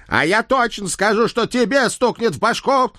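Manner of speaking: high horse voice barking threat; menacing bark. A narrator's reading. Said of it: "грозно рявкнул Центурион".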